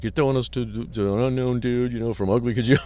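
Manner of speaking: put-on protest, not truly shouted; mock hollering. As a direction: imitate her freak-out at half volume. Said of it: "started screaming and hollering".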